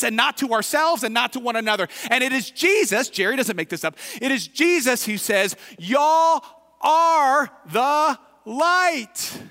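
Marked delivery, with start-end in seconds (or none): 5.8-9.4 "Y'all are the light" word by word, each high and drawn out; great emphasis. Acted. Preacher quoting Jesus to the congregation, emphatic proclamation. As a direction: proclaim every word as a long, loud call. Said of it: "he says it with emphasis".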